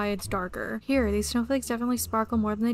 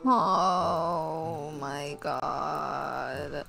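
very slow, low, drawn-out groan; exasperated; 7 semitones lower, much slower than usual. Real, not acted. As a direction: stretch every word out in low despair. Exasperated at the missing goat.

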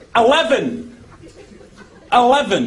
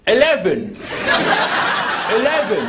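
mock English accent; 5 semitones lower than usual. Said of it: "Try an English accent".

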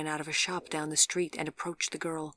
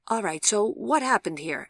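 louder, higher, brisk official voice; questioning policeman; 5 semitones higher, 7 dB louder than usual. A narrator's reading. Policeman arriving at an accident scene, loudly questioning. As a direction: take charge with a firm question.

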